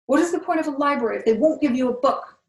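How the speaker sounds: high, loud furious outburst; a loud, raging shout about the library; angry yell. A narrator's reading. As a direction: yell it out in frustration.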